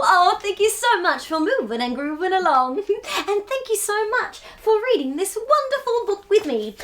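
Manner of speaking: ordinary delivery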